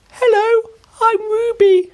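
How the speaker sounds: put-on a bright little girl introducing herself; Ruby smiling. A narrator's reading. Ruby greeting Oliver, very high cheerful girl voice. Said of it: "the girl said, smiling".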